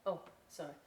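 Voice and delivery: plain reading voice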